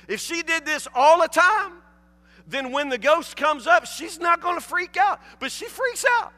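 high, loud preacher's reasoning; worked-up comic insistence; exasperated argument. Real, not acted. Preacher argues loudly, comic exasperation.

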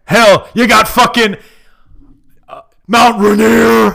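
loud promo bellow; hype-man yell; hype shout. Pretend. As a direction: shout it to the crowd. Same hype bit.

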